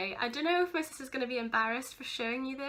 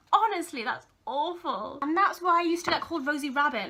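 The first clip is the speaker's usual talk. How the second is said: ordinary delivery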